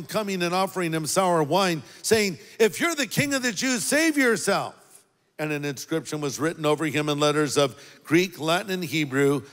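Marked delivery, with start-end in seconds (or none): 2.6-4.7 higher, faster taunt at the cross; mocking soldiers. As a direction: throw the words up at him as a sneering soldier. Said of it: "The soldiers also mocked him".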